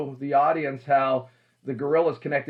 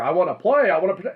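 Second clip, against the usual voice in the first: higher, louder mock of an eager actor; 4 semitones higher, 6 dB louder, faster than usual. Acting coach mimics actors who wanna work in here, mock eager. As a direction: play an overeager performer pleading to play. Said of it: "Actors wanna work in here".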